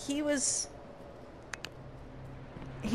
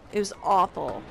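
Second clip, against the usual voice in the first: ordinary talk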